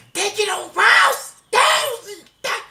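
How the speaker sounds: high, loud preacher shout; pastor shouting. Retold or imitated. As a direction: bellow it like a fired-up preacher. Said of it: "Toda vez que o pastor grita muito". (grito de pastor exaltado)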